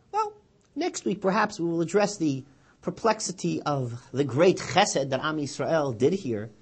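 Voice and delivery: normal talking voice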